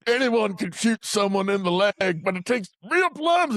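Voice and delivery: slow, high, drawn-out challenge; mock tough taunt. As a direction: stretch out the taunt theatrically. Acted taunt before attack.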